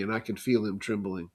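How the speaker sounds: ordinary delivery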